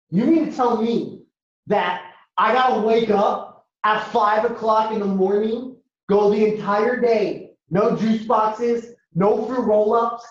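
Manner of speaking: slow, drawn-out disbelief; incredulous kid. Acted. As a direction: spell out each hardship slowly in disbelief. Acted disbelieving kid protesting to mom.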